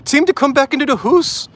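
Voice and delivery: high, hammed-up Irish accent on hoose; a rough, jokey Irish brogue. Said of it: "The accent needs work".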